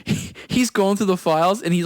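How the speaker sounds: usual speaking voice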